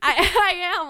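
high, loud burst through laughter; laughing. Real, not acted. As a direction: break up laughing as you speak. Laughing at own failed accent.

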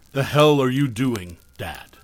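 plain reading voice

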